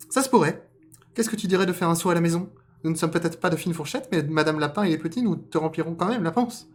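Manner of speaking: high, loud cartoon voice; affable rabbit. Rabbit inviting fox home to dinner, high voice. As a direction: chatter out the invitation at a high pitch.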